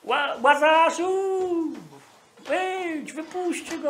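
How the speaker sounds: mock a weak pleading call to Lazarus; meek plea. Preacher mocks a feeble, pleading prayer to Lazarus. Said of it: "To on nie powiedział".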